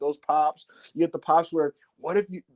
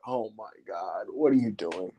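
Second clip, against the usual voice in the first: shocked hush; 8 semitones lower, 6 dB quieter than usual. Retold or imitated. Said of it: "Dom is like".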